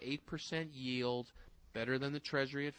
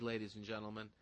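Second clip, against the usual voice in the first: mock grand host intro, lower at the end; announcer voice; 3 semitones lower than usual. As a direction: introduce the guest like a showman.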